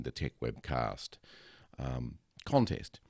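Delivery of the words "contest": ordinary talk, nothing marked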